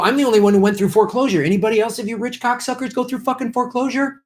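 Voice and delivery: high, fast, heated challenge; fired-up debate jab. Pretend. Imagining himself on the debate stage, taunting rich rivals, fired-up. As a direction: rattle it off like grabbing the debate mic. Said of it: "I'd go".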